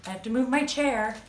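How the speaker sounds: usual speaking voice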